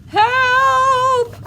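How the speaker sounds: very high, loud shriek; cry for help. A narrator's reading. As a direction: shriek in exaggerated panic. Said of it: "the other one cried".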